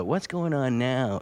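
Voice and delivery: audience member. Retold or imitated. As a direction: draw it out like someone lost in the plot. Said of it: "the more people wouldn't go".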